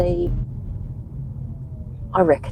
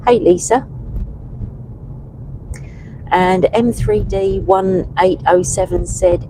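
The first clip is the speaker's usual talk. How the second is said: usual speaking voice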